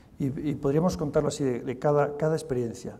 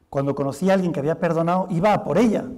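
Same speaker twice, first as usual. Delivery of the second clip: ordinary talk, nothing marked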